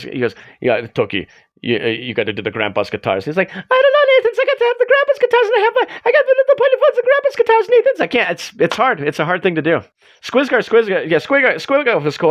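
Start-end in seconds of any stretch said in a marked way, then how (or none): 0.6-3.2 low growled Nathan voice; Nathan Explosion. Retold or imitated. Streamer imitating the metal singer talking to his guitarist, low growl. 3.5-8.0 put-on very high Scandinavian-accented Toki voice. Streamer imitating the Norwegian guitarist answering Nathan, high Scandinavian accent. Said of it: "Do it, Toki".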